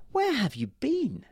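high, slow questioning mother; a mother asking, puzzled. Acted. Mother voiced by narrator.

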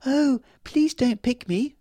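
very high, slow little flower voice; tiny pleading cry. A narrator's reading. Flower fairy begs the big man not to pick it: very high tiny plea. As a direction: beg small and frail. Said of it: "a tiny cry".

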